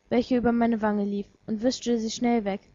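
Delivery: usual speaking voice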